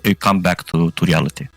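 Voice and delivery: usual speaking voice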